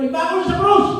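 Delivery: a hint of a mob yelling; mild mock shout. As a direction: call it like a jeering crowd member. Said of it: "Pag may sumigaw".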